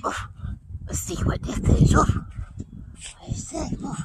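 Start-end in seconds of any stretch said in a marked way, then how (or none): 3.2-4.0 whispering. Real, not acted. Sniffing something in park.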